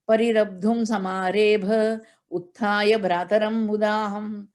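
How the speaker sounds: steady chanted Sanskrit recitation; scripture chant in class; verse recitation. Acted.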